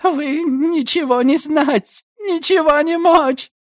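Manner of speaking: put-on very high, slow desperate foreign accent; broken-Russian plea. A narrator's reading. Swedish girl pleading with commissioner, high, broken Russian.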